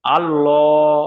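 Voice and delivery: আল্লাহ with a full, rounded heavy L; heavy Allah. Shown as a demonstration. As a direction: say আল্লাহ with the lam thick and the mouth round. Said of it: "ল করে পড়তে হবে".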